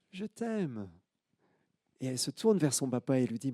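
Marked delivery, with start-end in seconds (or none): 0.1-0.9 high, soft faraway repeat; the call coming back as an echo; faint distant echo. Acted. Echo answers the girl.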